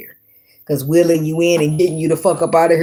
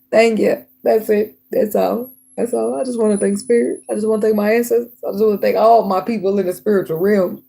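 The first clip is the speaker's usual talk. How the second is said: mock acceptance speech; 6 semitones higher than usual. Mock award acceptance thanks.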